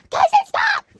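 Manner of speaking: high, loud frantic yell; screaming. Retold or imitated. Handcuffed teen yelling at fighting friend, frantic high scream. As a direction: shriek at her to stop. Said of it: "I'm screaming on the floor".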